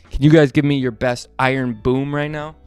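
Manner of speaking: usual speaking voice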